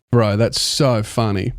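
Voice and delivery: usual speaking voice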